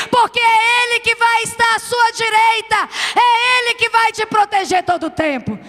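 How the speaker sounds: high, loud shouted sermon; fiery preaching. Acted. Pastor exhorting the church at full force.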